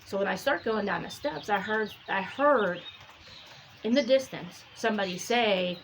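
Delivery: ordinary delivery, nothing marked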